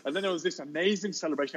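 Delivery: usual speaking voice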